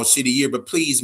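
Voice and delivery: ordinary talk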